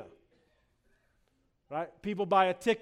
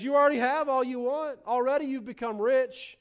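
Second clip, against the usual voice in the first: high, loud mock congratulation; sarcastic; 6 semitones higher, 4 dB louder than usual. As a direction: read it as pointed sarcasm, pitched up. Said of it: "the sarcasm really begins in verse eight".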